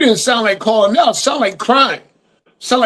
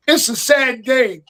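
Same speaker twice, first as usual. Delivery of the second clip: ordinary delivery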